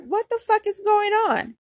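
high-pitched, baffled question; acted-out incredulous shock; alarmed disbelief. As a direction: pitch it up in retold bewilderment. Retold shock at being pulled over.

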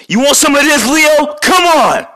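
high, loud shouted dare; hothead challenge. Acted. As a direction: pick a fight, shouting up high. Hothead turtle challenges Leo.

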